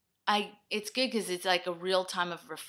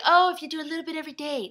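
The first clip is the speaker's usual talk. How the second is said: mock advice voice; 9 semitones higher, 7 dB louder, much faster than usual. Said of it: "It doesn't work like".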